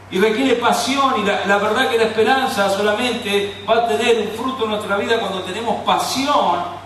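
high, loud, fervent preaching about passion; full-force preaching. Acted. Preacher urging the congregation to passion, loud and fervent.

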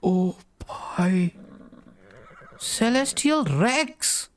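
high, slow amazed calls; awestruck. Real, not acted. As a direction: breathe out the name in awe. Amazed seeing Celestial Rex.

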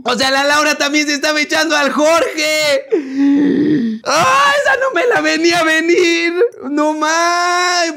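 high, loud wailing amazement; real high-pitched disbelief; thrilled shock. Real, not acted. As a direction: squeal at a twist nobody saw coming. Still reacting to the twist.

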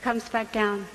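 ordinary delivery

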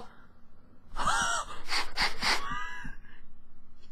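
high unvoiced shriek of laughter. Real, not acted. Near miss in game. (kreischendes Lachen)

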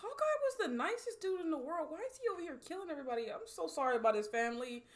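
normal talking voice

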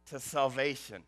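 ordinary talk

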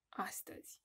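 soft whispered aside; hushed teacher whisper. Shown as a demonstration. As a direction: drop to a secret whisper. Teacher whispers word to kids.

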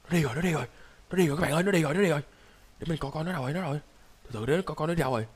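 soft, secretive commentary; hushed watching. Pretend. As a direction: keep it down while keeping watch. Watching the monster leave while hiding.

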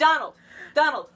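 loud high call. Real, not acted. Host loudly calling for her friend's answer. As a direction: shout the name to get attention. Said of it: "calling out excitedly".

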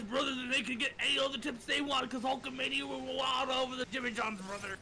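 light wrestler promo delivery; Hulk Hogan voice. Retold or imitated. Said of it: "do the Hulk Hogan voice".

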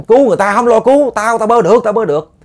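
high, loud insistent yelling; la làng. Retold or imitated. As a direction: shout them away to save the other person. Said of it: "ổng mới la làng lắm". (la làng gắt gỏng, giọng cao và lớn)

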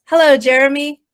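ordinary talk, nothing marked

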